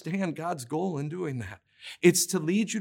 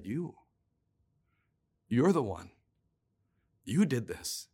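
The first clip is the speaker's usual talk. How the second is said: low, soft, pointed accusation; quiet accusation; 5 semitones lower, 6 dB quieter than usual. A narrator's reading. Nathan pointing at David.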